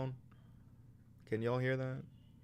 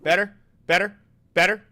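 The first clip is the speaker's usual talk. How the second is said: high, louder repeated question; loud check; 6 semitones higher, 11 dB louder than usual. Shown as a demonstration. Testing the mic, loudly asking if it is better. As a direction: call it out loud. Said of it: "how about that".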